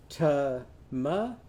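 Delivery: "Ta-ma-ta" slowly as tuh-muh-tuh; "uh" in every syllable. Shown as a demonstration. Teacher repeats for children, "Ta-ma-ta" slow with "uh" vowels. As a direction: leave gaps inside "Ta-ma-ta", short "uh" each time. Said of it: "Once again, try it".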